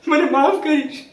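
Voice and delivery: high, insistent plea for forgiveness; urgent plea. Acted. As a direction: plead it out, pitched high. Repeats plea to mother more urgently.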